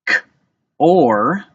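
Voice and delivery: "K, or" as a short k, pause, long loud or; sounded out. Shown as a demonstration. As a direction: tap out "K, or" in two sounds. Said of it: "Tap it out with your fingers".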